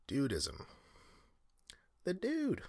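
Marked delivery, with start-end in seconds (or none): 2.1-2.6 the Dude, said much higher and drawn out; a playful Dude impersonation, pitched way up; high, goofy take on the Dude; voicing the Dude. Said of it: "Okay, I won't do it anymore".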